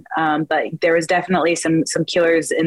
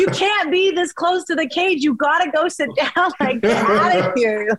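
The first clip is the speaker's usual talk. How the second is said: laughing scold; 10 semitones higher than usual. Retold or imitated. Telling mom to sit down.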